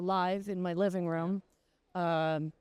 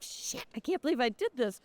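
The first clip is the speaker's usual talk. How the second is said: mortified; 6 semitones higher than usual. Retold or imitated. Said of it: "And I'm like".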